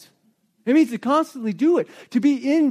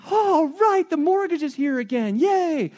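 sarcastic cheer; 6 semitones higher than usual. Retold or imitated. Sarcastic mock cheer at bills.

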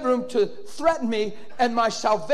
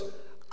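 long, thin, airy scream; imitated scream; 6 dB quieter than usual. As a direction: imitate a drawn-out scream at held-back volume. Imitates the girl's cry on deliverance.

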